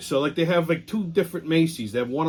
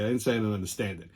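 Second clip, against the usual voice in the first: fast and softer, lower, thrown away; quickly re-explain it to yourself, softer and lower; retelling the line afterward, fast and softer; muttered restatement; 6 semitones lower, 6 dB quieter, faster than usual. Retold or imitated. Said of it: "I didn't say I didn't fucking".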